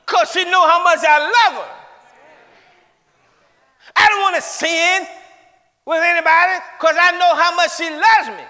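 high, loud preacher's cry; preaching at full force. Acted. Preacher shouting love to the church. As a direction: shout it to the congregation.

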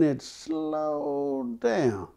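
high and very slow, stretched out like the log easing to a stop. A narrator's reading. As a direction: draw each word out, high up. Log slows at the mountaintop.

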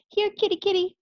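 high, sing-song call to a cat. A narrator's reading. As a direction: memanggil kucing dengan nada tinggi. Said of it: "she called out".

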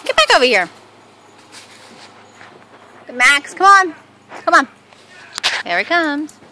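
ordinary talk

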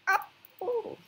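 sudden very high yelp; a shocked squeak at the screen; startled squeal. Real, not acted. Gamer yelping as the beast catches him.

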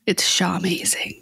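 slow secretive whisper. Acted. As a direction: breathe it like a secret. Whispered teaser word.

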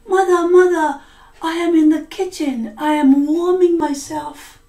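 put-on high, loud, fast little girl voice; high daughter voice. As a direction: call back as the hiding daughter. Blood answers as the daughter.

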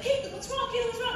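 high, mock-concerned repeated question; mock fussing chorus. Dinner guests crowding Caitlyn, high mock concern. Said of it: "everybody was like".

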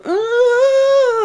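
high shrieking mock cry; high child's shriek; imitated child scream. Kids screaming in the bath.

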